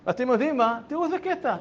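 ordinary delivery

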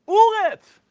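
put-on "אורץ" said as "uretz" in an old Yiddish accent, high and loud; mispronounced blessing. Old man ends the bread blessing with "אורץ" for "ארץ", loud and high. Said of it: "אמרת אמוץ אליך מן האורץ".

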